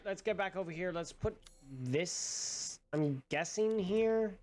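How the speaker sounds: usual speaking voice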